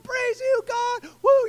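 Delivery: a healed man losing it with joy, near crying; freaking out. Retold or imitated. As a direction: ecstatic high yelp. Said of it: "He's freaking out".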